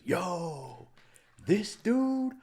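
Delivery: loud, high ring-announcer holler; Bruce Buffer. Retold or imitated. Heckler turns to announce to the crowd, booming holler. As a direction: stretch each word out like a fight announcer. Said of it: "Bruce Buffer".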